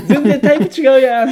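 high, loud shocked outburst; shocked outcry. Retold or imitated. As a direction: cry out in disbelief. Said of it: "ってなった時に".

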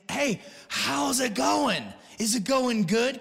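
high, slow, eager call to a brother; excited greeting. Retold or imitated. Brother hails Nehemiah on arrival, excited call. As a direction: greet him loud and glad.

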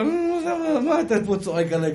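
high-pitched mock indignation; a burst of acted indignation; acted vengeful retort. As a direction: snap back in pretend outrage. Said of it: "רצון לנקום כזה".

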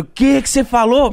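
high, mock-shocked question; shocked challenge. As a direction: demand it as if you misheard. Retold shocked challenge in bed.

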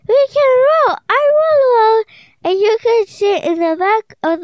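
put-on high, eager girl voice; eager little girl. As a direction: announce it proudly and high. Child voicing Tina.

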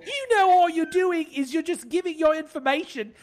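high Cockney scolding; hammed-up Cockney accent, high and loud; imitating Cockney guard. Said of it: "She continues by saying".

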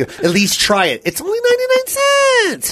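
mock pleading, leaping up high at the end; pushy friend. Imitating his friend urging him to try it, mock wheedling. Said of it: "He'll say shit like that".

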